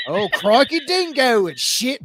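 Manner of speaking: a light mock Australian accent. Shown as a demonstration. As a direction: blurt it like a cartoon Aussie. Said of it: "My Australian".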